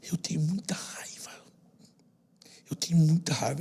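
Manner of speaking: put-on whispered, the boy near tears; a hushed, broken admission; whispered confession. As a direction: breathe it out like a crying child. Crying boy confesses his anger.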